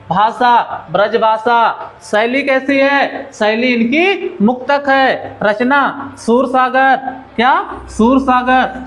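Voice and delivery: slow dictation, item by item; slow, unhurried recap. Shown as a demonstration. Teacher repeats Surdas's key facts for students, slowly and unhurriedly. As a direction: take each fact at an easy, slow pace. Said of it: "फिर से आराम से".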